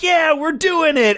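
high, slow gleeful cheer; mock cheer. Republicans cheering on the riot, high mock cheer. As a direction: cheer it way up high like a pumped rioter. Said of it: "every Republican who was like".

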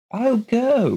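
high, slow, strange woman's voice; odd woman voice. Acted. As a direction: play her vague and a little off. Mrs Colgate insisting.

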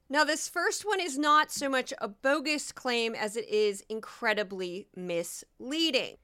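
usual speaking voice

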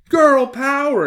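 very high, loud cheer; exclaiming little girl. A narrator's reading. Hilary cheers for the packed lunch, exclaiming. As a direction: cheer it out in a high girlish shout. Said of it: "exclaimed Hilary".

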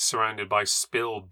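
ordinary delivery

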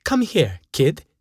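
high-pitched summons, lightly voiced; mushy man calling. A narrator's reading. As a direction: call the kid over as a mushy character. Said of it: "cried a mushy man".

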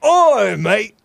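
high, loud mock Australian call; Australian accent. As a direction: holler it like a cartoon Australian.

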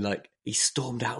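normal talking voice

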